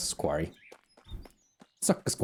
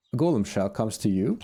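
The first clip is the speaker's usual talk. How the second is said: normal talking voice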